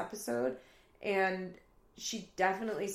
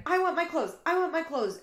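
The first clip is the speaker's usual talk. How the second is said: high, loud mock tantrum; retold screaming; 9 semitones higher, 6 dB louder, faster than usual. Roommate demanding her clothes, mock screaming. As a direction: imitate an insistent scream, pitched up. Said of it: "Janelle's screaming".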